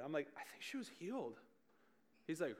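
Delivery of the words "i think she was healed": dumbfounded. Retold or imitated. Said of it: "I'm dumbfounded".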